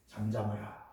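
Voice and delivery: low, firm command to the wind; a hint of Jesus rebuking the storm; commanding the sea. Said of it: "이렇게 말씀하셨어요".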